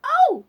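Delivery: high, very loud shriek; short piercing scream of shock. A narrator's reading. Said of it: "screamed all the dolls together".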